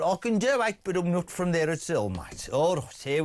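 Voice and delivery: mock Birmingham twang, dropping away at the end; Birmingham accent. Shown as a demonstration. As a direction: show off a quick Brummie accent. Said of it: "I am not from Birmingham".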